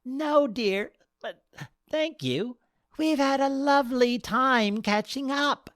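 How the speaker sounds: a hiccuping crasher declining a cab; tipsy old lady. Acted. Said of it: "The short wedding crasher hiccuped".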